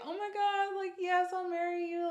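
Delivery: high, gushing replay of saying yes; squealy re-enacted delight; thrilled acceptance. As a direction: act out an overjoyed, high-pitched yes. Acting out her proposal acceptance.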